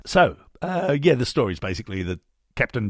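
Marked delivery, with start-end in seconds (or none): none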